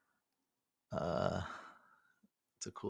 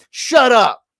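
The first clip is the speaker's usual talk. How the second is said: shout; 14 semitones higher, 11 dB louder than usual. Acted. Mocking the complainers.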